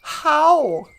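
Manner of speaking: loud, gushing mock greeting; mock impersonation. Someone told he's Ojibwe, loud mock greeting. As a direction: parody a clueless over-eager hello. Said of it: "tell somebody I'm Ojibwe".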